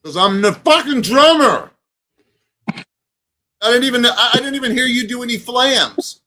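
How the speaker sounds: indignant. Real, not acted. Drummer indignant boast.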